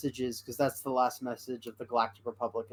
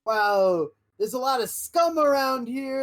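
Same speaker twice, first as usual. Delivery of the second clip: much higher and louder, wide-eyed; awed kid; 9 semitones higher, 5 dB louder, slower than usual. Retold or imitated. Kid entering the cantina, high and awed. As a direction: squeal it like a kid in awe. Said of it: "and it's like".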